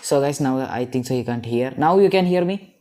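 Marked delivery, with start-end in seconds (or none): 1.8-2.8 calling out. Real, not acted. Checking after unmute.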